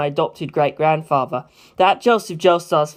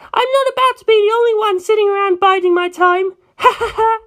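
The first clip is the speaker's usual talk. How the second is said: high, tearful laugh; laughing through tears; 4 dB louder than usual. Acted. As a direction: force a laugh while crying. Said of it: "Even her laugh was choked with tears".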